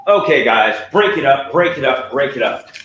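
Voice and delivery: loud commanding yell to break up a fight; real yell. Real, not acted. Owner ordering his fighting dogs apart, loud yell.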